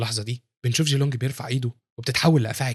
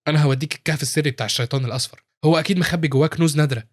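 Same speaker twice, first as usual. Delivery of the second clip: ordinary delivery, nothing marked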